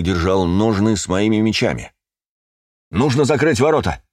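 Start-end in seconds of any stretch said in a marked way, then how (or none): 2.9-4.0 высокий командный окрик; крикни приказ на бегу. A narrator's reading. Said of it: "крикнул я почти догнавшему". (громко выкрикивает)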